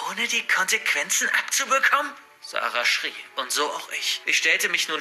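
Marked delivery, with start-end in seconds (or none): none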